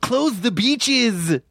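slow, high dog voice; dog Dreyfuss voice. Acted. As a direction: play a dog doing Dreyfuss. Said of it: "That's Dreyfuss, the dog".

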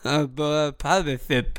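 talking while holding the tongue; holding tongue with fingers. Shown as a demonstration. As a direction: say it with your tongue pinched. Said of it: "Hold your tongue and say".

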